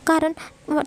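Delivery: ordinary delivery, nothing marked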